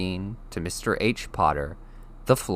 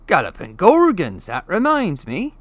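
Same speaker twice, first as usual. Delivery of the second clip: higher, louder burst of remembering; sudden exclamation; 11 semitones higher, 9 dB louder than usual. A narrator's reading. Hagrid suddenly remembering something, louder and higher. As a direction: blurt it out as it suddenly hits you.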